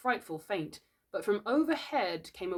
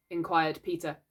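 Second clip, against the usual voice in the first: ordinary delivery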